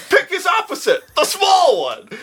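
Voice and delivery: high-pitched mock shouting; hammed-up crowd clamour; excited crowd. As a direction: call it out high and laughing. Acts out the crowd choosing.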